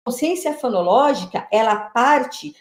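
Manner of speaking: usual speaking voice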